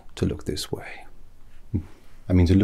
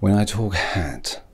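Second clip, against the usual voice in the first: normal talking voice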